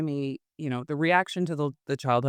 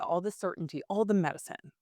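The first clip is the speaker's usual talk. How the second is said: ordinary delivery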